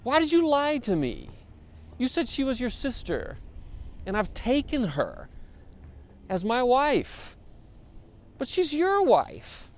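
high, indignant Pharaoh, slowing at the end; angry Pharaoh. Acted. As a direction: play the wronged king demanding answers. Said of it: "he comes to Abram's like".

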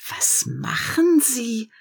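slow, hushed whisper. A narrator's reading. The tree asking itself, anxious whisper.

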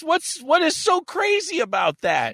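excited protest. Real, not acted. Cohost protests loudly.

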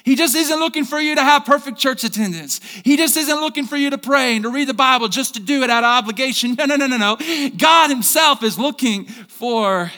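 loud, high preaching at full force; fired-up preaching. Acted. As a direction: preach it out to the whole church. Pastor preaching to congregation.

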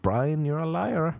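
mock accusation. Repeating the accusation to Brian.

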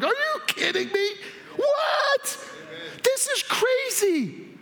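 high, half-laughing, half-crying disbelief, shouting What; overwhelmed and can't believe the gift, laughing through tears; incredulous laughing cry. Acted. Preacher reliving his shock at God's gift, a high, laughing, incredulous shout. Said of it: "And I'm like".

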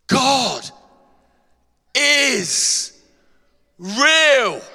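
loud, high ringing shout of realization; inner cry. Acted. Preacher voicing the heart's sudden cry, loud high shout. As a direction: cry it out as if your soul just woke up. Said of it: "suddenly says".

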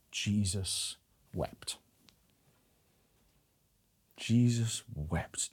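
very slow, soft, lingering; hushed reverence. Acted. Preacher savouring the shortest verse, slow and hushed. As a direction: let it land slowly and softly.

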